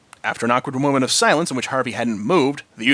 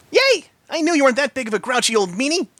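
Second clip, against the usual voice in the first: happy Pinky; 11 semitones higher than usual. A narrator's reading. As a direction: squeal with delight. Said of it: "she responded happily".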